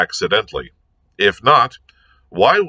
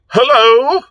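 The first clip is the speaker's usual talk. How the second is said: much higher, louder shouted hello; yelled hello; 14 semitones higher, 6 dB louder than usual. A narrator's reading. Lord Peter yelling into the telephone to a deaf lady, loud shout. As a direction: yell it down the line to be heard. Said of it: "yelled into it".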